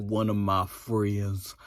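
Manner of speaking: mock druggy drawl, dragged out; imitated drugged drawl. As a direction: badly imitate his sluggish, low voice. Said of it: "I can't do his voice".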